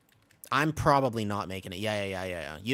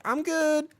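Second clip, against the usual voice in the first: much higher, squeezed I'm good; strained fake reassurance; 4 dB louder than usual. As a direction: pretend you're fine while drowning. Struggling swimmer claims fine.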